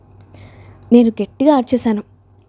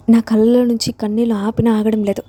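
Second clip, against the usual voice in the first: ordinary delivery, nothing marked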